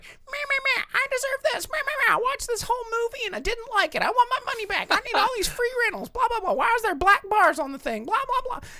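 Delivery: very high, nasal-free squeaky mock whine; mocking the heinous customer in a squeaky high voice; mocking whiny customer. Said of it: "Just like".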